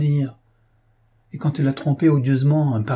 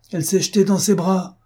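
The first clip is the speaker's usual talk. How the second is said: mounting exaltation; 8 semitones higher than usual. Acted. Jilted suitor to Madame Desmarets, exploding with exaltation. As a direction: let the betrayal boil over in a rising outburst. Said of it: "continua-t-il avec une exaltation croissante".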